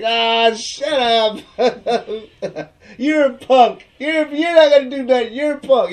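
high, loud laughing yell; mock yelling with laughter. [name] shouting at Howie, laughing mock yell. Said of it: "He's yelling at him".

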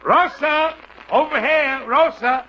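loud, high call across a hall; loud cheerful call to Rosa. Acted. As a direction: hail someone across a crowded theatre. Calling Rosa across the theatre.

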